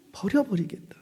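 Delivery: whispered echo of the verdict; ominous whispered repeat. Acted. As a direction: breathe the threat out in a whisper.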